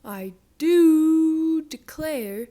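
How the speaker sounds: slow, loud drawn-out moo of a line; loud mooed exclamation. Acted. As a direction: moo the words like a cow. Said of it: "Mabel mooed".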